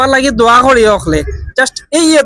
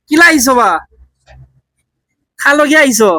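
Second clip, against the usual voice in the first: Sylheti Bengali questions, raised and patient; Sylheti dialect. Shown as a demonstration. To a confused old man, questioning him in Sylheti dialect. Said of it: "Sylheti ভাষা try করে দেখি".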